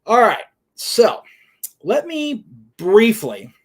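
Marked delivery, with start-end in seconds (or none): 1.8-3.5 "briefly" drawn out slow and loud, higher; slow emphatic "briefly" to make a point; stress on briefly. Real, not acted. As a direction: lean hard on "briefly", stretching it. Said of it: "and I stress the word briefly".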